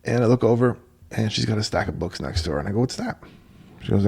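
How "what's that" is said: surprised question. Retold or imitated. Surprised at stack of books.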